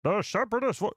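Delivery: high newsreel announcer; Clone Wars narrator. Acted. Streamer retrying the show's opening line, Clone Wars narrator impression. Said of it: "I'll do my impression".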